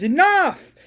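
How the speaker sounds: high, loud one-word shout; acted scream. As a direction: cut him off with a yell. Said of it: "Rick screams".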